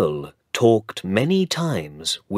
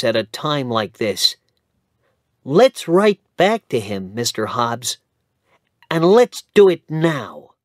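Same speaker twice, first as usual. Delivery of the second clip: a lightly raised, eager friend's voice; kindly Dick; 7 semitones higher, 5 dB louder than usual. Acted. Dick urging his friend Hobbs to write back, eager. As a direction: press Mister Hobbs to act now.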